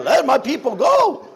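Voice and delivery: high, loud sermon cry; pumped-up preaching. Acted. Pastor demonstrating his Passover sermon, pumped-up shouting. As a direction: belt it out as a roused preacher's command. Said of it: "that was my sermon".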